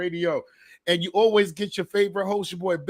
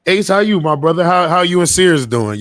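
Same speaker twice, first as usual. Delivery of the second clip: louder, hearty check-in; loud greeting; 10 dB louder than usual. Real, not acted. Host asks Ace about Sears, loud and hearty. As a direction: call across the room to Ace.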